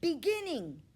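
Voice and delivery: class shouting the word, high and loud; shouted. A narrator's reading. Second graders shouting the word on the board, loud. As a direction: shout the answer together. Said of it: "they shouted it out".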